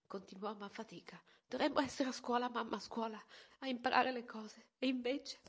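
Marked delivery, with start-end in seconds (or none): 1.5-5.4 più acuta, spezzata dal pianto; voce rotta. A narrator's reading. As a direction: parla trattenendo le lacrime. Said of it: "Poi la voce si ruppe". (figlia che si sfoga con la madre, voce rotta)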